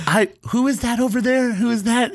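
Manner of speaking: high, eager mock gasp of a friend; imitating excited friend. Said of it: "And she goes".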